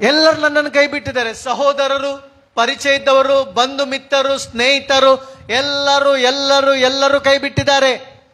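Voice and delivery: emphatic list, chanted out on a sustained note; impassioned preaching. Acted. Preacher echoing Job's lament that everyone left him, fervent. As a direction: hammer out each repetition.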